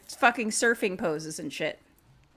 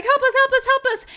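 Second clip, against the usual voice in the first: high, loud, frantic; panicked plea; 7 dB louder, faster than usual. Acted. Jenny Ann pleads desperately into the wall phone. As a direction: beg for help. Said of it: "she's like".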